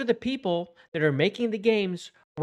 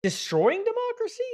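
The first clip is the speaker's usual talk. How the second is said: incredulous; 13 semitones higher than usual. Real, not acted. Mocking an absurd claim.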